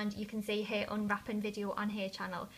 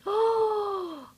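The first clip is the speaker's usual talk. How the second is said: excited gasp; 10 semitones higher, 4 dB louder than usual. Real, not acted. Sees the blush colour.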